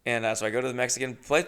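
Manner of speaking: ordinary talk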